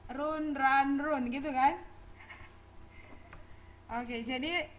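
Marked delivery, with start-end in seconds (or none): 0.1-1.3 slow "run, run, run" as roon, ran, roon; habitual mispronunciation. Retold or imitated. Teacher showing how students answer when asked, "run" as "roon". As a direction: mimic the bad habit, "run" with a long oo. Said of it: "jadi kebiasaan kan ketika ditanya".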